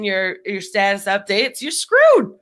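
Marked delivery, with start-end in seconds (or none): 1.6-2.3 high, loud exclaim; dramatic exclamation. Acted. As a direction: squeal it out. Dramatic punchline.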